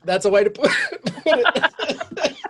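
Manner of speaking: high, breaking into helpless laughter; real laughter taking over mid-sentence; cracking up. Real, not acted. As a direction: laugh through the words. Cracking up at co-host's joke.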